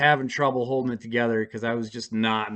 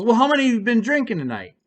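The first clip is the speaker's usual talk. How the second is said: higher, louder, fast cop interrogation; imitating the officer; 8 semitones higher, 5 dB louder, faster than usual. Officer quizzing the driver, fast and loud. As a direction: rattle it off like a cop. Officer questions him at car.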